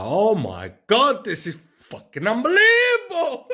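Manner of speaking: re-enacted ecstatic scream, climbing very high; top-of-lungs scream. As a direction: belt it out slowly like someone stuck in a loop of amazement. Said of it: "he's screaming from the top of his lungs".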